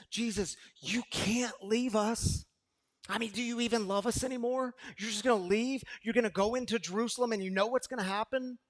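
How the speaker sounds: lightly voiced anxious disciple; Peter pleading. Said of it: "Peter stands up and he says".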